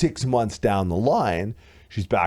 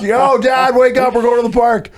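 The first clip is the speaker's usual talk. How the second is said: very high, much louder overjoyed dog; excited dog voice; 11 dB louder than usual. Retold or imitated. Dog cheering about a trip to the park, ecstatic. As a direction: bark it out like a thrilled pup. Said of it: "The dog was like".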